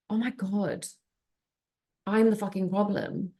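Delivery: hushed, spaced-out epiphany; acted stunned self-discovery; retold dawning realisation. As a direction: let it sink in, softly.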